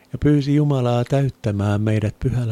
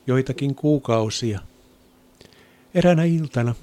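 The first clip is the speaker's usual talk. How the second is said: ordinary talk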